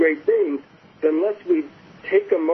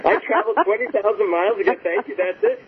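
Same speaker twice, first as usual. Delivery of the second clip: higher-pitched, retold disbelief; mock incredulous; 6 semitones higher than usual. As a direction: replay your indignant surprise at a letdown. Retold letdown after long journey to guru.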